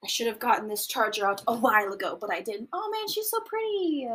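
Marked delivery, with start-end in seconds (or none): 2.7-4.1 admiring. Real, not acted. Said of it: "admiring photo".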